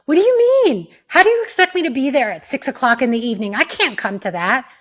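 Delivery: loud, high-pitched indignant protest; defensive and aggressive. Shown as a demonstration. Coach showing the wrong way to refuse an invitation, defensive and aggressive. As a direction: fire back fast, affronted and defensive. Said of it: "The minute you get defensive or aggressive".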